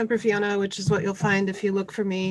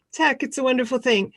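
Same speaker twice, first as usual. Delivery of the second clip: ordinary talk, nothing marked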